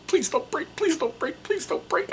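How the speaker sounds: very high, soft, anxious begging; pleading. Pretend. A nerd begs his brittle miniature not to break, high and soft. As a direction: plead with the model not to snap.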